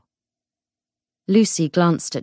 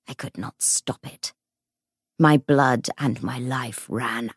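ghostly whisper; 4 semitones lower, 4 dB quieter than usual. Acted. Ghost figure speaks.

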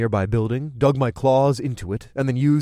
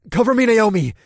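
loud, raised-pitch urgent order; shouted command; 10 semitones higher, 7 dB louder than usual. A narrator's reading. Driver orders the witch in the back seat, commanding. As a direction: call it out hard and high. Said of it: "I commanded the witch in the back seat".

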